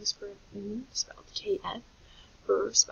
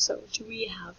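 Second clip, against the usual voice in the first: normal talking voice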